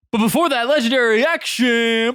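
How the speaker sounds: game master announcement. Pretend. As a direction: announce the boss's big move, stretching the words. Game master announces a boss move.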